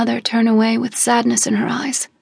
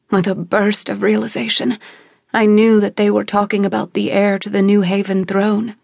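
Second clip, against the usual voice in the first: usual speaking voice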